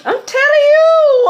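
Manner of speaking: very high, loud, drawn-out cry; piercingly high emphatic wail of vindication; excited holler. Real, not acted. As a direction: holler it out high, stretching the last word. Exclaiming at the card she sees.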